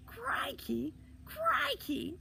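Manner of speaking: very high squeaky hoot; high, loud kid jeering; wild mocking hilarity. A narrator's reading. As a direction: shriek it mockingly from the treetop. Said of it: "the voice in the tree laughed wildly".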